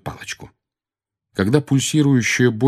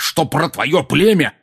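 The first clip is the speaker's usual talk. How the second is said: slow, higher, louder on племя; raised angry voice; 7 semitones higher, 4 dB louder, slower than usual. A narrator's reading. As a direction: lean hard on племя, still loud before hushing. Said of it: "дядя понизил голос".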